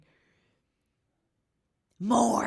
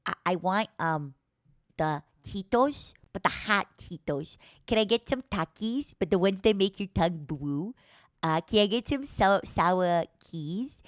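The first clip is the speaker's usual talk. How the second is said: usual speaking voice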